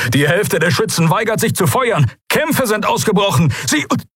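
high, rising shouted alarm; captain shouting for help before being hit; frantic report. Acted. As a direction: yell over the gunfire until cut off. Captain under fire reporting.

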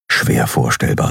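plain reading voice

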